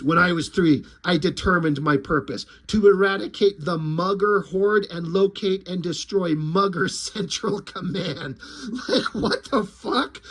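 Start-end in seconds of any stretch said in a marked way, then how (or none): 0.0-8.4 deadpan action-hero growl; grim vigilante. Retold or imitated. Popo continuing his vow, low brooding voice. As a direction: lower brooding monologue, cracking into a laugh at the end. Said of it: "So then Popo continues".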